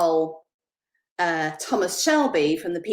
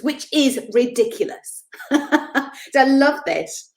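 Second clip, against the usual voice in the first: laughing; 6 semitones higher, 4 dB louder than usual. Real, not acted. Laughs at own remark.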